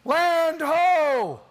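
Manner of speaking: high, loud, drawn-out sailor's call; lookout's cry. Acted. Lookout waking a sleeping ship with a land sighting, shouted long and high. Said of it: "all of a sudden you hear".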